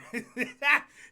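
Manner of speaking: pained laugh at the heat; laughing through the burn. Real, not acted.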